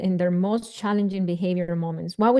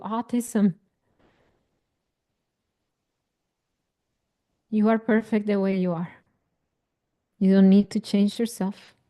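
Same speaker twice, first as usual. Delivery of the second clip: guided meditation. Acted. Coach guiding parents through an inner child meditation, soothing. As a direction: talk them gently into the memory.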